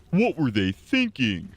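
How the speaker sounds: slow high mock. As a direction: echo yourself in a silly voice. Mocking own mistake.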